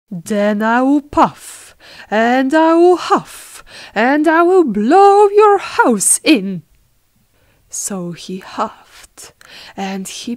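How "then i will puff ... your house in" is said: big storybook threat, building louder and higher; threatening wolf. Acted. Wolf warning the pig he will blow, threatening. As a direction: build the threat to a loud, high peak.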